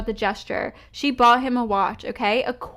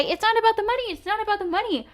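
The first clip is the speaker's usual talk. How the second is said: high, sing-song mocking imitation; mocking quote; 10 semitones higher, much faster than usual. Quoting the other side's slogan back in mockery. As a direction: parrot their line back in a high mocking voice. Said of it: "Yet they're gonna say".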